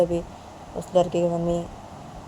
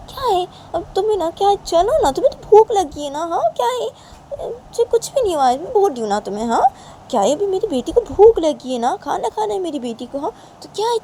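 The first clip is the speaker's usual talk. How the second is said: very high, loud, whiny coaxing; mother's high-pitched hurrying; fussing mother; 12 semitones higher, 5 dB louder than usual. Acted. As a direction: fuss over your hungry child, pitch soaring.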